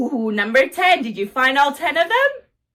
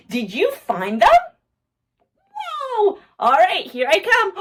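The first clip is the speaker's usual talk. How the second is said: normal talking voice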